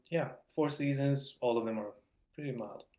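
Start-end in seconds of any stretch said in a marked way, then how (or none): none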